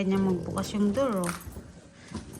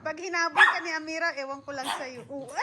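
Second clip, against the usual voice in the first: ordinary delivery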